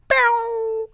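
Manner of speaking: loud, high yowl; short, very high-pitched comic wail; imitated reaction noise. Host imitating the embarrassed tipper, high loud noise. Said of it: "that dude was like".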